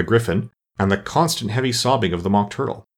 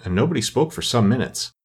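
usual speaking voice

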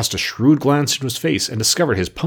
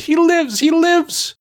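much higher, louder screaming triumph; 5 dB louder, much slower than usual. Acted. Mombi screams with joy that Jack lives, high and loud. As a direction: scream it in wild delight. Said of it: "she screamed".

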